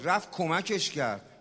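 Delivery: normal talking voice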